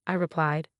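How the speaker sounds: plain reading voice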